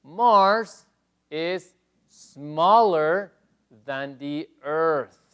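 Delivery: teacher reading the slide question slowly; slow model reading. Shown as a demonstration. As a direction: spell it out slowly for the class. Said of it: "Pues dice".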